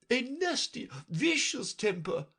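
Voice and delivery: very high, imperious royal voice; Red Queen. A narrator's reading. Red Queen lecturing Alice, high and imperious. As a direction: talk down to the child. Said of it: "the Red Queen remarked".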